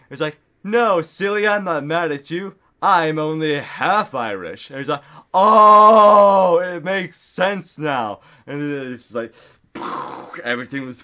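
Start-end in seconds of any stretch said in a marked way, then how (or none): 0.6-4.7 higher, louder teasing retort; playful reassurance. Retold or imitated. Friend reassuring that she is not mad, playful. 5.3-8.2 huge, drawn-out loud "oh"; exaggerated realisation. Learning the friend is half Irish, exaggerated realisation.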